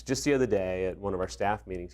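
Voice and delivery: usual speaking voice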